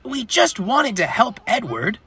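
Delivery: high-pitched little engine pleading innocence; childlike wail of self-defence; protesting cry. A narrator's reading. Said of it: "cried Bill".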